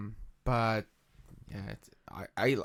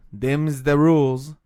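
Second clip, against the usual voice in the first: put-on Brooklyn accent; 2 semitones higher, 11 dB louder, slower than usual. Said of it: "if that was your Brooklyn accent".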